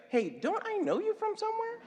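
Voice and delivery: high-pitched, put-on questioning voice; comic squeaky pretend recognition; mock puzzled questioner. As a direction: pitch it way up like a mock baffled stranger. Acts out a question to Joe.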